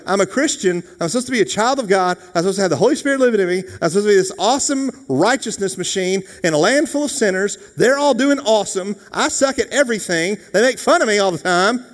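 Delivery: high, loud, hammed-up whine building to a yell; exasperated rant. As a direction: pile up the grievances, climbing to a frantic peak.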